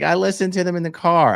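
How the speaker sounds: put-on high matter-of-fact teen; daughter's voice. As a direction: answer like an obvious kid. Daughter quoted.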